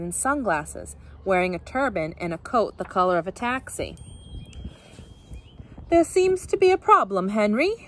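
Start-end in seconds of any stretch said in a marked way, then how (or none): 5.9-7.9 put-on high-pitched, affected English accent; fake English accent. A narrator's reading. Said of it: "she said in a fake English accent".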